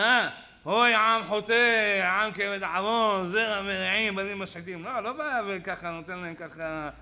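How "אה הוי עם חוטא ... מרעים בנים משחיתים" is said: loud, slow, drawn-out denunciation; thundering rebuke. Acted. Preacher looming over the sinful people, thundering rebuke. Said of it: "הוא לא בא מעל העם ואומר".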